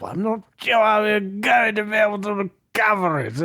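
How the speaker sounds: understated posh British voice; a hint of a British gent fretting over the car; mock British gent. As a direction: keep the fussy English gentleman going. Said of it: "He just gets more British as he goes".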